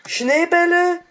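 high squeaky question; Schneebälle squeaked in a put-on high voice. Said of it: "ich kann nicht so hoch sprechen".

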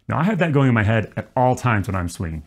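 ordinary talk

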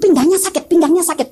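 tiruan ibu mengeluh. Retold or imitated. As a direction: imitate a mother insisting it hurts. Retold mother complaining of back pain. (tiruan keluhan dengan nada naik)

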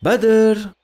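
high, loud call across a distance. Acted. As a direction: call his name loudly, searching. Searching, calling out for Badr.

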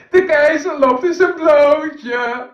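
put-on high, loud, slow child's cry; boy shouting. Little boy shouts that the emperor is naked, high and loud. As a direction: yell it out for the whole street. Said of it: "riep het jongetje".

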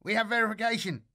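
high, loud excited screech. Acted. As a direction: screech it over the radio. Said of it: "screeched Grishin in his ear".

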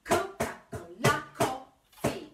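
measured chant of "Coca-Cola", hitting "Co" and "la"; accented first and last syllables. Shown as a demonstration. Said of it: "accents on the first bit of the word and the last".

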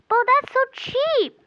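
very high, loud squealed protest; mock outrage. Customer complaining about the price to the seller, shrieky mock outrage. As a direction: stretch it into a squeaky play-acted wail.

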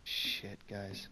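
hushed whisper, hiding from danger; whispering while hiding. Real, not acted. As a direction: breathe the words, scared. Hiding from the killer.

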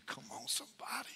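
breathy whisper to the room; whispered aside. Real, not acted. Pastor nudges the congregation in a whispered aside. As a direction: lean in and whisper it.

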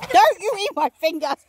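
high playful cry at the animals; playful yelp. Real, not acted. Feeding the goats, she yelps playfully. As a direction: yelp it as they nip your fingers.

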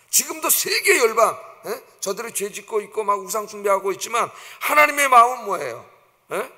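ordinary talk, nothing marked

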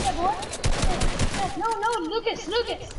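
high, loud hype shouts; excited yelling. Real, not acted. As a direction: yell it out mid-fight. Excited callouts in a fight.

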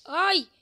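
loud high yelp; startled yelp. Real, not acted. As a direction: cry out in a sudden scare. Sudden scare.

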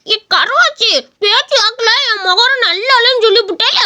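high, whining indignation; hammed-up hurt pride; wounded protest. Insulted uncle protests about his face.

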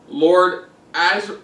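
normal talking voice

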